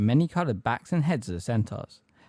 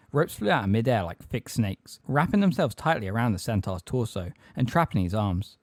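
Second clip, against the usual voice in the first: plain reading voice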